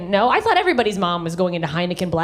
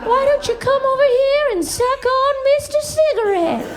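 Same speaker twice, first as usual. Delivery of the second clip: very high creepy squeak; talking cigarette; 14 semitones higher than usual. Acted. Cigarette tempting the child, very high squeaky voice. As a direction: coax in a tiny cartoon voice. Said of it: "the cigarette would be like".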